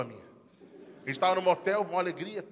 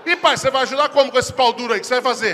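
put-on higher, louder teasing kid voice; cheeky kid; 6 semitones higher, 8 dB louder than usual. Son loudly mocking his dad. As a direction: needle dad like a smart kid.

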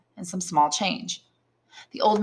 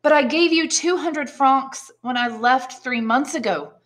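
higher, halting protest; mild stammer; 7 semitones higher than usual. Acted. Son baffled at father's missing money, mildly stammering. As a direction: stumble on the first word, then push on. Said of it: "stammered Edmund".

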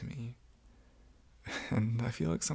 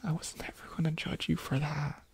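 tender whisper; 6 semitones higher than usual. Acted. To the crying roommate in a hug, a tender whisper. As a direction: whisper close as you hug them.

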